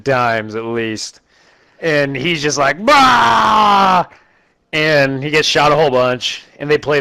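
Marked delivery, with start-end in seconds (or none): 2.8-4.0 high, loud hammed-up bellow; mock roar of pain. Giant hit by a hail of bullets, mock bellow. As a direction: shout it like a giant shrugging off bullets.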